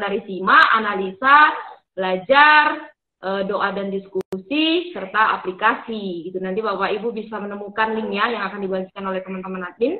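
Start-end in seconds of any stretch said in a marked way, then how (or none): none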